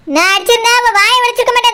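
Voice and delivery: usual speaking voice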